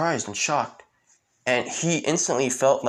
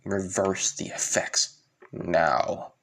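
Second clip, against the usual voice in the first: low, slow, deliberate threat; menacing command; 3 semitones lower, 3 dB quieter, slower than usual. Retold or imitated. Asta with sword at his throat, menacing. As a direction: space the words out, low and slow.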